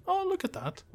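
high, sing-song mock exclamation; mock wonder. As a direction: gush over something nobody can see. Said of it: "the audio of us going".

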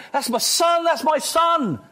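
high, excited cry of fatherly pride; proud father exclaiming. Acted. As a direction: call it out, overjoyed at your son. Voicing the Father's pride at the cross.

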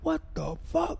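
shocked what-the-hell; stunned disbelief. Retold or imitated. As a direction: react like you can't believe your eyes. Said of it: "And I'm like".

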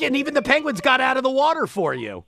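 ordinary talk

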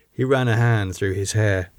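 ordinary delivery, nothing marked

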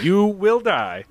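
slow, ominous mock warning; mock dire warning. Cohost warning listeners off the drinking game, slow mock doom. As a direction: drag out the doom for laughs.

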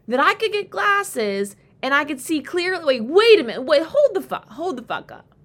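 loud, high, astonished hold up; disbelieving, excited shout; excited disbelief. Pretend. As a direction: shout it like a sudden revelation. Imagined self realizing with glasses.

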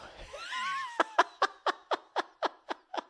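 long, high wheezing laugh; real laugh. Real, not acted. Comic finishing the bar story, real high wheezing laugh. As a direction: crack up helplessly, way up high.

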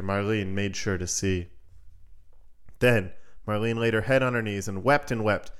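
usual speaking voice

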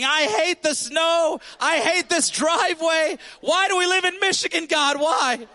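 high, hammed-up frustrated yell; mock yelling. Pastor retelling yelling at snow in driveway, hammed-up high yell. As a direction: rant at the snow like a fed-up man. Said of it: "So I start yelling".

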